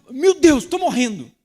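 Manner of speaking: high, loud mock wail of despair. Pastor mocking an irritable person's overreaction, high loud wail. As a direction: ham up the overreaction over burnt food.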